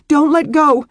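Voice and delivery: high, loud desperate cry; shout. Acted. Beth begging her husband not to loosen his hold, shouting. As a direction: scream it, clinging on for dear life. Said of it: "she shouted".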